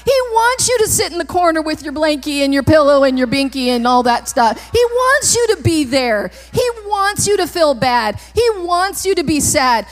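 forceful sermon in driving repeated phrases; preaching. Real, not acted. Preacher on what Satan wants from you, forceful preaching. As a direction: hammer out each repeated phrase.

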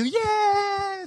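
put-on high, drawn-out child cheer; excited little girl. Four-year-old sister agreeing to be rescued, high cheer. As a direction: cheer it in a tiny girl's voice.

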